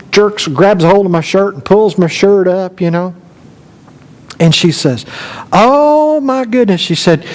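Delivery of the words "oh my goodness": high, drawn-out "Oh" of alarm; retold exclamation, pitched high; alarmed granny. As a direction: imitate a granny's shocked dismay. Granny reacts to the stings.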